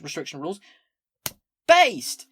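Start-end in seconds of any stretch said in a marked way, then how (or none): 1.7-2.3 loud, high one-word yell; cheering shout. Real, not acted. Streamer cheering a policy just read, shouting. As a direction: shout it out in hearty approval.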